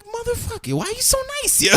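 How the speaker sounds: very high comic exasperation; mock outrage. To the ex handing over a blanket, mock outrage. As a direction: protest his niceness in a squeaky-high complaint.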